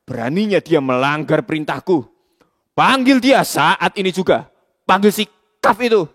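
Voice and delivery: enraged boss orders Kaf brought; furious shout. Acted. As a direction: roar orders in fury.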